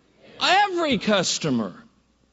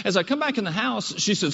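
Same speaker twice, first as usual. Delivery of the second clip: ordinary talk, nothing marked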